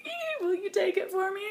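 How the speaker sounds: high squealing mock-giddy ask. Asking friend to take photo with star, giddy squeal. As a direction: squeak it out like a starstruck fan.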